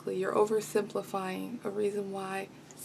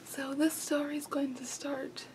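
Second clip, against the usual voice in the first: soft whispered intro; hushed storytelling start; whispering; 4 semitones higher, 6 dB quieter than usual. Real, not acted.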